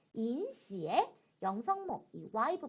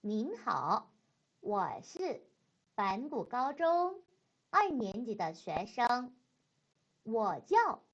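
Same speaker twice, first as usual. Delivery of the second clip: slow Mandarin, phrase by phrase with pauses; slow practice model; much slower than usual. Shown as a demonstration. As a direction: say it in careful chunks for learners to repeat. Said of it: "선생님 따라서 면접 중국어 한번 연습".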